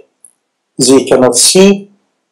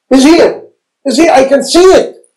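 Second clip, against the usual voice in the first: excited desert wanderer; 10 semitones higher than usual. Acted. Acting out a desert walker seeing mirage.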